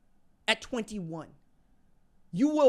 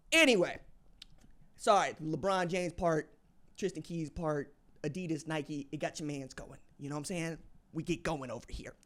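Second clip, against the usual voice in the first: ordinary talk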